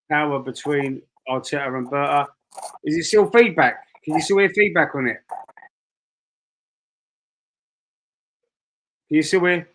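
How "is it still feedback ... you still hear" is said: thin voice no bass; thin tinny sound, no bass; tinny mic. Real, not acted. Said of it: "it sounds like tinny".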